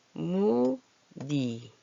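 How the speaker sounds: 무늬 drawn out very slowly as mu-ni; stretched pronunciation. Shown as a demonstration. Teacher shows how 무늬 sounds, slow and drawn out. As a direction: stretch both syllables of 무늬, ending in a simple ni. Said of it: "이렇게 소리가 나죠".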